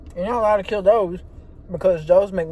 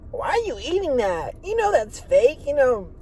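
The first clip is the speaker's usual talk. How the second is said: a hint of a higher-pitched nagging critic; higher, mildly mocking busybody; mock food critic; 7 semitones higher than usual. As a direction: lightly mimic someone nosy questioning a meal. Imagined critic nagging about his food.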